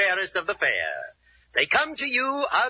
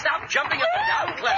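alarmed shout; faster than usual. Acted. Calling out as she jumps about.

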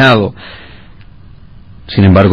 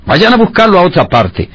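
high, stern refusal; 11 semitones higher than usual. A narrator's reading. Innkeeper turning Joseph away, stern and high. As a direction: send them away firmly. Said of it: "habló con rigor".

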